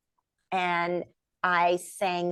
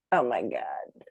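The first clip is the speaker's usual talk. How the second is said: low, hushed disbelief; stunned, half-whispered awe; awed oh my God; 8 semitones lower than usual. Retold or imitated. Overwhelmed on hearing the invitation.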